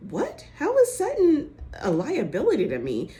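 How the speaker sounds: high-pitched mock incredulity; acted indignant disbelief. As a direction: imitate a friend taken aback and offended. Acted indignant comeback to Erica.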